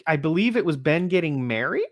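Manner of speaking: ordinary talk, nothing marked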